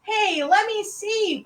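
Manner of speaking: high, loud, stretched-out plea; eager boy. A narrator's reading. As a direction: push in excitedly for a look. Eager brother wants to see frog.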